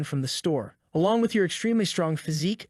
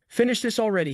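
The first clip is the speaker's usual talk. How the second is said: higher, forceful order; commanding; 6 semitones higher than usual. Acted. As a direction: bark the order at the doctor. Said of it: "he commands".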